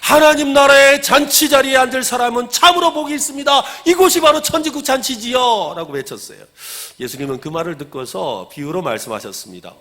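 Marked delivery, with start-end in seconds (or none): 0.0-5.7 high, loud, moved cry; loud exclamation. Retold or imitated. Diner moved by Jesus at the table, exclaiming loudly. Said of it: "크게 외쳤습니다".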